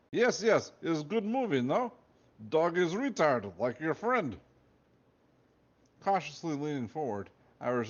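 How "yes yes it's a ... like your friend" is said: high Russian guard voice, eager; eager guard in a put-on Russian accent. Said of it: "He had a thick Russian accent".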